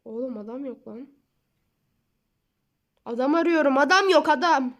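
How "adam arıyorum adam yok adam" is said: loud, frustrated rant, higher; annoyed outburst. Real, not acted. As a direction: vent the frustration loudly. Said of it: "Biraz sinirlendim".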